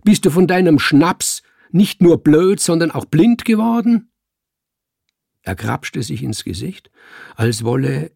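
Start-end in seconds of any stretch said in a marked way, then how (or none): 0.0-4.1 loud, high scornful bellow; roaring back. A narrator's reading. Cat mocking the man as drunk and blind, roaring. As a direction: yell the mockery at full voice. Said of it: "brüllte ich, wie er brüllte".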